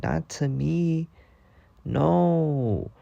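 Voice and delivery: high, stretched-out sass; sassy refusal. Retold or imitated. Worker retells rebuffing a finger-waving customer, sassy and high. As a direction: draw it out, refusing to be summoned.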